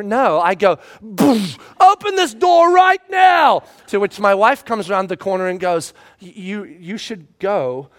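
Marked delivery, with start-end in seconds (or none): 1.0-3.6 much higher, loud commanding shout; furious father shouting, slow and forceful; angry demand. Retold or imitated. Father yelling at locked door. 6.2-7.9 softer, slow, hushed warning; wife murmuring slowly that he should leave; quiet wife. Retold or imitated. Wife sending him away.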